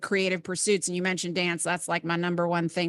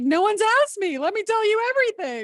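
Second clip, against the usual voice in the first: much higher, eager gushing voice; eager mock relief; 14 semitones higher, 5 dB louder than usual. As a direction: gush like a relieved criminal. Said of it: "They're like".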